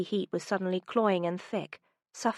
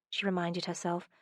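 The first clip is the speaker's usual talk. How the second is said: plain reading voice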